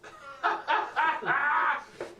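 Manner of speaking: big burst of laughter; loud laugh. Real, not acted. NPC petting the cat.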